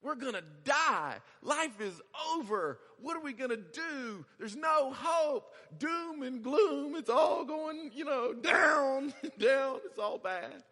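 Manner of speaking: high, loud, slow hammed-up despair; panicking wail. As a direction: wail like all hope is gone. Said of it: "the servant just panics".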